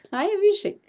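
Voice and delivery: normal talking voice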